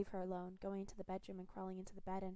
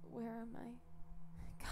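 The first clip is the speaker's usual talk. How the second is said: dazed and weak; 4 semitones higher, much slower than usual. Acted. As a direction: drag each word out half-conscious. Dazed wounded prisoner.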